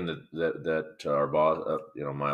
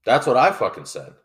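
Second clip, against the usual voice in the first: loud indignant agreement; 7 semitones higher, 9 dB louder than usual. Real, not acted. Agreeing with co-host about tie, loud outrage. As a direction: belt it out, fully fed up.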